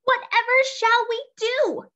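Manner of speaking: high, loud anxious witch; fretting witch. Acted. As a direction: wring your hands as the witch. Worried witch laments.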